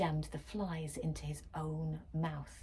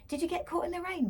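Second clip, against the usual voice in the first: much higher, fast mother voice; lightly voiced mother; mum's voice; 12 semitones higher, faster than usual. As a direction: rattle it off like a busy mum.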